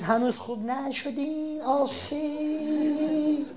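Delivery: high, slow sing-song pity; pitying visitor. Acted. Visitor to a sick patient, slow high pitying sing-song. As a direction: coo over the sick patient.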